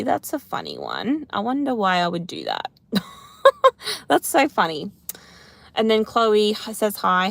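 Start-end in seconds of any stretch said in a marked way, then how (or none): 3.4-4.0 short high burst of laughter; laughing. Real, not acted. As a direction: laugh out at the silly tip. Reacting to a funny comment.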